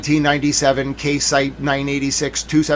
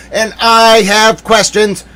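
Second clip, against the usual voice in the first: mock indignation; 6 semitones higher, 8 dB louder, much slower than usual. Mock indignant demand for answers.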